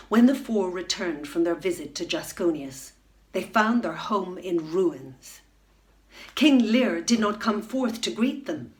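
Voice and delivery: usual speaking voice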